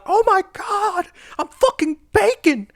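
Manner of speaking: hammed-up Kenobi screaming in pain; mock panicked scream. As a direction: shriek it like a cartoon victim on fire. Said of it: "He's, like, screaming".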